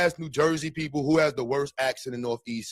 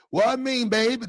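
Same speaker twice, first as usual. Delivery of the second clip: put-on higher New Orleans woman's accent; 8 semitones higher, 3 dB louder than usual. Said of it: "the women from New Orleans voice".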